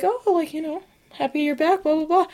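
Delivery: soft, high offhand roommate voice; imitating roommate's greeting. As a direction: toss off a light, high imitated hello.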